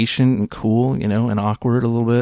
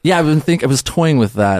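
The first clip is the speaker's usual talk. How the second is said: usual speaking voice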